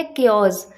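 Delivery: केओस said as kay-oss, hard k; correct pronunciation. Shown as a demonstration. Teacher giving students the correct केओस, kay-oss. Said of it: "correct pronunciation इसकी होती है".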